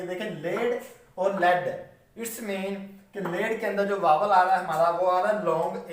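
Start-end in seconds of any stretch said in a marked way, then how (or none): 0.0-1.7 first लेड with a long a, second लेड with a short e, said slowly; contrasting vowels. Shown as a demonstration. Teacher contrasts the vowels of लेड and लेड for students, slowly. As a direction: draw out लेड then clip the second लेड. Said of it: "इनकी sound आपको पता लगनी चाहिए".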